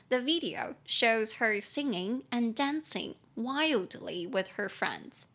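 very slow, careful reading, fading at the end; slow model reading. Shown as a demonstration. Teacher reads the example sentence slowly, fading at the end. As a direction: read it out slowly, let it trail off.

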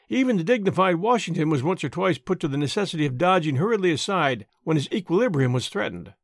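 ordinary delivery, nothing marked

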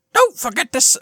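put-on a failed try at an Irish accent; attempted Irish accent. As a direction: start the line in a shaky Irish accent. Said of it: "What's my Irish accent?".

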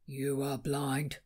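low, slow, blunt rebuttal; a hint of a gruff young man; dry counter. A narrator's reading. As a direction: drop it flatly and slowly. The tower counters Falk.

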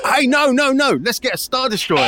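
high, loud eager yell; one-up the plan, thrilled; excited shout. Acted.

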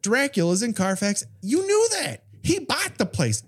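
high, mock-amazed gasp of discovery; hammed-up surprised realization; mock surprise. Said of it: "and like".

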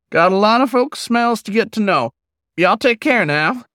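put-on high, friendly old-timer hello; a kindly old drifter calling out; old wolf, folksy greeting. Old wolf greets the pup at the campfire, folksy and high.

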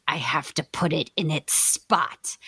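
put-on slow, whispered creepy child; eerie whisper, drawn out slowly. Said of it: "she says in this really creepy voice".

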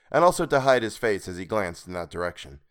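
ordinary delivery, nothing marked

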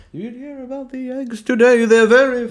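high chatty townsfolk voice; NPC townsperson. Retold or imitated. Imitating Assassin's Creed crowd chatter, high. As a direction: gossip like a background villager. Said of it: "it's just like".

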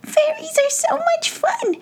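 very high tiny pixie voice, giggling; gleeful little pixies; squeaky pixie. Acted. Pixies teasing the fairies, squeaky glee.

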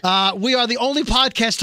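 usual speaking voice

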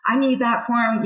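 imitating the boss yelling down the phone; retold yell. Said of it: "he's yelling at me".

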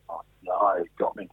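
low, soft, sinking voice; retold defeated near-mumble; sheepish admission. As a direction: drop right down as if caught out. Retold being caught out on air.

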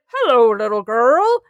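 slow, gentle Santa greeting; kindly Santa. Retold or imitated. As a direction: greet a small child like Santa, slowly. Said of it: "And he's like".

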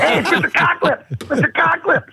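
high silly toy voice; playing Mister Cocklips for the kids. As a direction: squeak it out like a kid's toy.

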